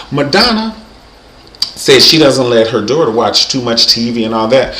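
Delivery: ordinary talk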